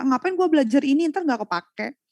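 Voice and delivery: high, loud mock student complaining; whiny student. Student asking why bother learning, mock whine. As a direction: mimic a student who doubts the point of studying. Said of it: "Banyak kayak gitu ya".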